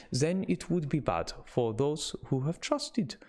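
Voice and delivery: Van Helsing in a lightly exaggerated Dutch-German accent. Reading Van Helsing's letter to his friend in a Dutch accent. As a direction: read the letter as the old Dutch professor.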